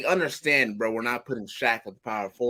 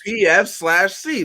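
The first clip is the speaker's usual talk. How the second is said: emphatic; 6 semitones higher, 7 dB louder, slower than usual. Real, not acted. Insisting on position label.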